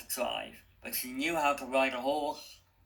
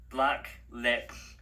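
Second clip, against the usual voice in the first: ordinary delivery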